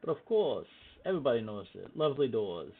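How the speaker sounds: loud character voice of a shopkeeper; loud, emphatic merchant; emphatic shopkeeper reply. Acted. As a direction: assure the customer loudly, in character. Shopkeeper character answers the soldiers emphatically.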